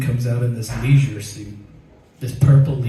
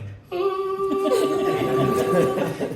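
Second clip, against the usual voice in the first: heavenly sound; 4 dB quieter than usual. Retold or imitated. I heard, like.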